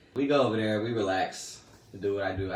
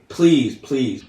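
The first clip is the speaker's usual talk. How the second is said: mock coach pleading; 3 semitones higher, 8 dB louder than usual.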